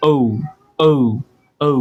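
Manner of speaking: "ও" as a gliding oh-oo; correct letter O. Shown as a demonstration. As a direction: close the lips from o to u on "ও". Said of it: "তারপরে এটা কি হয়ে যাবে?". ("ও" ওউ-এর মতো, শেষে উ)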